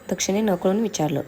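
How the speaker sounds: ordinary delivery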